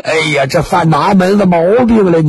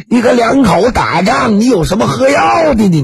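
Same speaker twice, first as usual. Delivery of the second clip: scolding shout; 6 semitones higher than usual. Acted. Neighbour scolding the wife for taking poison, shouting. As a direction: shout her down like an angry neighbour.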